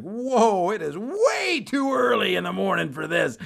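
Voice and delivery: high, loud, overwhelmed protest; taken-aback clerk. Retold or imitated. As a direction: imitate a clerk recoiling from the onslaught. Clerk recoiling from his anger.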